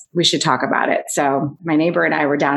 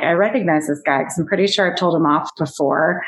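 usual speaking voice

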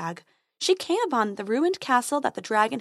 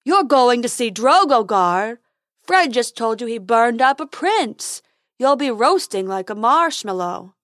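troll Fred; 3 semitones higher, 7 dB louder than usual. Acted.

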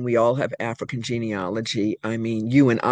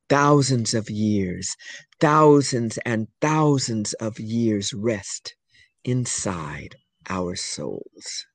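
drawn-out closing refrain; 5 semitones lower, much slower than usual. Acted. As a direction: stretch the repeated thousands and let it die away. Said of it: "closing refrain, repeated and slowed".

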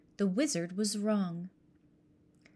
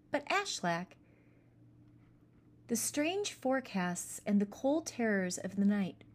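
usual speaking voice

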